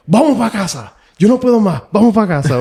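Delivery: high-pitched mock exasperation; frustrated dad. Dad giving up on the driving lesson, high frustrated outburst. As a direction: imitate a fed-up dad, voice jumping up high. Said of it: "my dad's getting frustrated with me".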